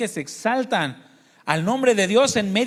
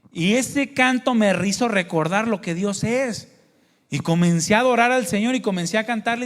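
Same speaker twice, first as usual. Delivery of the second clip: ordinary talk, nothing marked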